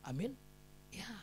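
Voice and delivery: ordinary talk, nothing marked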